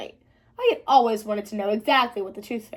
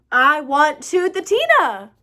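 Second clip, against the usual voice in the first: loud, slow, stubborn whine; whiny demand; 2 semitones higher, 6 dB louder, slower than usual. Acted. Girl demanding her tooth fairy from her brother, slow loud whine. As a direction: insist like a sulking child.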